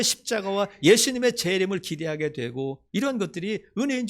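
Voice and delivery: loud, high impassioned sermon; fervent pulpit exhortation; full-force preaching. Acted. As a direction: preach it out to the whole church.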